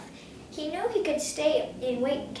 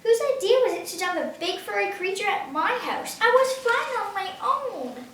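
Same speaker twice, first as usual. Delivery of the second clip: annoyed Otto; 7 semitones higher, 4 dB louder than usual. A narrator's reading.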